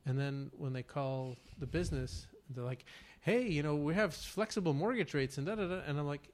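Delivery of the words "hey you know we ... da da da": mock eager mortgage pitch, louder and higher; cheery sales caller, lightly hammed; telemarketer voice.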